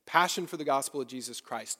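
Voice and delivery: ordinary delivery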